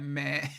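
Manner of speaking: drawn-out imitated bleat, close to laughing; sheep bleat. Explaining how the exhaust sounded, sheep bleat. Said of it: "sounded more like a sheep".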